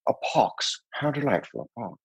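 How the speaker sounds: hushed, languid movie-villain impression; bad George Saunders. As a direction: do a bad, soft-spoken suave devil. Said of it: "I'm gonna do bad George Saunders".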